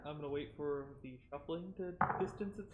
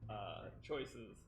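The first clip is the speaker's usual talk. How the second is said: sarcastic; 6 semitones lower, 9 dB quieter than usual. Acted. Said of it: "I roll my eyes heavily".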